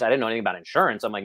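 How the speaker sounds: normal talking voice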